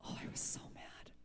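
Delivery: whispered, voiceless confession of anger; dramatic whisper. Real, not acted. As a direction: hush it like a guilty secret. Remembering anger at prophecy.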